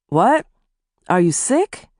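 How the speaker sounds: suddenly concerned husband; worried. Acted. Husband asking his wife if she is sick, mildly worried. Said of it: "asked in a worried voice".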